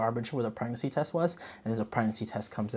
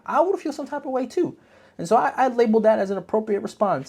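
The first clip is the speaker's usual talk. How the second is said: high-pitched emphasis; 10 semitones higher, 4 dB louder than usual. Real, not acted.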